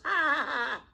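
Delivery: high mock laugh from a dying man; retold hospice laugh, a bit exaggerated; imitating his laugh. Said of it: "and he laughed".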